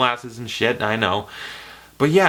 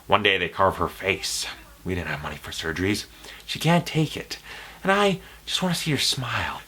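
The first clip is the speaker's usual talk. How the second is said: Joker monologue. Retold or imitated. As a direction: lean in and unsettle the listener. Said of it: "It's a monologue".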